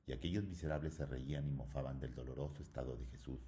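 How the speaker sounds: ordinary delivery, nothing marked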